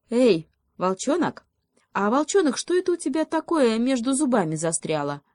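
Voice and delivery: high-pitched feigned concern; earnest little hedgehog. A narrator's reading. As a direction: inquire in a high, serious little voice. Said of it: "С очень серьёзным видом поинтересовался ёжик". (серьёзный высокий голосок ёжика)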